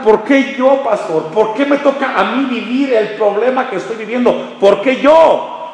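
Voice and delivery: high, pleading "why me"; complaining believer. Acted. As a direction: whine it like a suffering churchgoer. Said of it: "Y tú te puedes preguntar".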